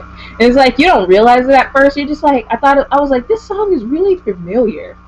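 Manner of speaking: high, lively retelling of the trailer moment; bubbling over with recognition; excited. Retold or imitated. To a friend, recalling the creepy song, excitedly.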